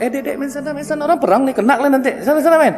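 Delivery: put-on brushing off little kids who wander into a war; dismissive grown-up shooing children away. Adults shooing kids from battlefield.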